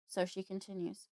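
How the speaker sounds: ordinary talk, nothing marked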